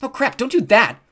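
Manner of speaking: slow, high, loud protest; dismayed shout; alarmed warning. A narrator's reading. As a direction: cry out to stop someone. Mallory alarmed at griffin being hit.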